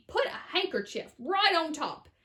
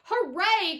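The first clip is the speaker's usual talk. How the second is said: loud, higher cheer; happy cry; 6 semitones higher, 6 dB louder than usual. A narrator's reading. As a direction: cheer with delight. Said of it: "cried Mister Wonka".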